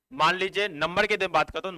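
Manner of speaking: ordinary delivery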